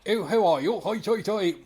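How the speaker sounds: sending up a badly written geezer; mocking bad-lad voice. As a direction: ham up a naff lad's greeting. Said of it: "he sounds more like".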